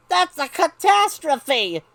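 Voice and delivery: high, loud, drawn-out outcry; dramatic protest. Acted. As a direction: wail it like a horrified kid. Boy protests losing his toys.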